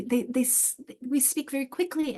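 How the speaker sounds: ordinary delivery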